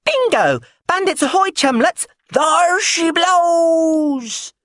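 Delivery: high, loud, triumphant cry, very drawn out at the end; triumphant shout. Acted. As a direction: bellow it like a pirate spotting a ship. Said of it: "said triumphantly".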